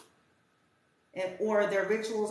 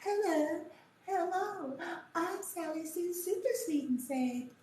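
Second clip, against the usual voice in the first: higher sugary mock-innocent voice; fake sweet girl; 10 semitones higher than usual. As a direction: play a syrupy fake sweetheart, mocking her. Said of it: "They say".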